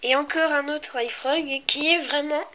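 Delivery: ordinary talk, nothing marked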